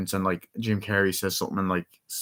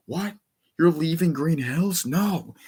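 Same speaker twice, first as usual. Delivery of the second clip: high-pitched imitated disbelief; raised, hammed-up protest; mock shock; 7 semitones higher, slower than usual. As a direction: play a dismayed cartoon hero. Acting out Sonic's shock at news.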